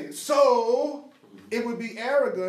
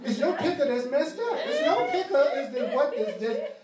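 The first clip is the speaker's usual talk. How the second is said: words squeezed out through high, loud laughter; giggling so hard the words go high; laughing; 10 semitones higher, 6 dB louder than usual. Real, not acted.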